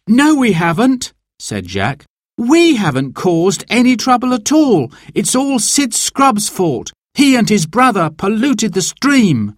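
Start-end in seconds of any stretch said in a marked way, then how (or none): 0.1-1.1 high, protesting voice; heated young objector; indignant denial. A narrator's reading. Jack hotly denies causing trouble. 2.4-9.5 high, heated self-defence; insistent, outraged denial; indignant protest. A narrator's reading. Jack argues, blames the Scrubbs.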